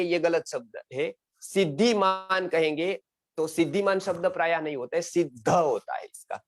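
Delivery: ordinary talk, nothing marked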